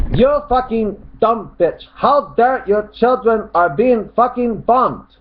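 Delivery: high, slow shouted run-on rant; mock angry shout. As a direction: shout it high with no break after "dare". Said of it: "He starts shouting".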